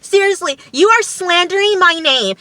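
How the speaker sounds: high-pitched, loud indignant rant; flipping out. Retold or imitated. Imitating Kara going off on Alex, flipping out. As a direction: go off on him, pitch way up, imitating her outrage. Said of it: "And Kara flips out. She's going off on Alex".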